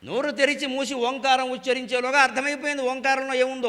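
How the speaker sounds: usual speaking voice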